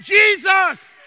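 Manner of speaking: high, loud shout of the name; full-force pulpit shout; crying out. Acted. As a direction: proclaim the name as a cry. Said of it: "they cried out to Jesus".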